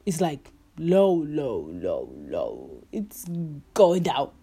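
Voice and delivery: voice sinking low. Pretend. Joking that Bitcoin is dropping, voice sliding down deep. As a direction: let the voice sink with the word.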